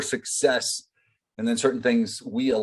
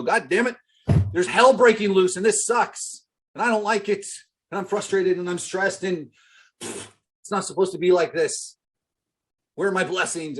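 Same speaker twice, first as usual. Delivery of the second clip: higher, whiny complaining rant; hammed-up moaning about the storm; mock frustrated rant; 7 semitones higher than usual. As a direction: play the fed-up victim griping at life. Mocking a frustrated person's rant.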